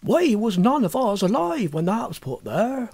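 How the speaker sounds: a village landlord reminiscing; lightly voiced old innkeeper; country landlord. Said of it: "said the landlord".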